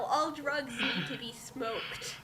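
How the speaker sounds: ordinary talk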